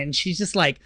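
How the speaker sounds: usual speaking voice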